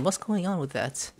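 usual speaking voice

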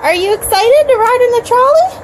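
put-on talking to a toddler. Parent speaking to toddler.

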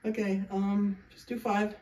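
normal talking voice

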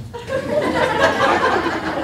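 real high burst of laughter; high-pitched amused cackle. Real, not acted. Preacher laughs at own joke.

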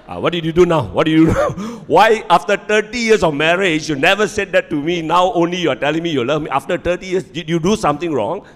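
loud, high, suspicious wife; a wife demanding what he's done, loud and high. Retold or imitated. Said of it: "She will look at you and says".

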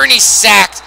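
sudden high, loud yell on the sack; excited call. Real, not acted. Commentator reacting to his quarterback being sacked, loud excited yell. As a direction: shout the sack out.

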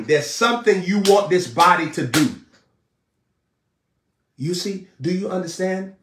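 shouting the message to the congregation; full-force preaching. Acted. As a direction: preach it out with rising fire.

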